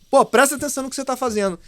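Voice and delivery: high, loud, quick telling-off; demonstrated shout. Shown as a demonstration. Speaker scolds inattentive audience as a demo, sharp shout. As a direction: shout to jolt a drifting audience awake. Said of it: "dar um grito, às vezes".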